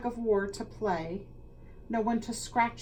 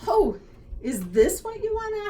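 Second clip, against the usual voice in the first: delighted realization; 13 semitones higher, 7 dB louder than usual. Acted. Librarian understanding.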